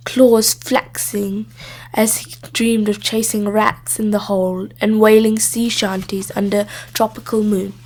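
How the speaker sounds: plain reading voice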